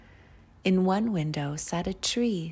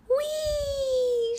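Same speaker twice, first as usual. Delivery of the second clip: very high-pitched gleeful "whee" squeal; 4 dB louder than usual. A narrator's reading. Snowflake twirling between the clouds, squealing. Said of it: "she squealed".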